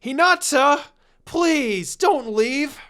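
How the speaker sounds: high, loud plea calling after her; desperate shout. A narrator's reading. Boy yells desperately after the departing girl. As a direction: yell it after someone walking away. Naruto calls after leaving Hinata.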